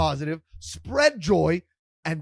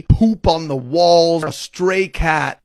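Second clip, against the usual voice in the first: ordinary delivery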